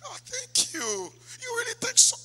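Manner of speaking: high-pitched, flattered lady voice. Acted. Lady lapping up a compliment from a man, high and flattered. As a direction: gush in a high voice, drawing it out. Acting out flattered lady's reply.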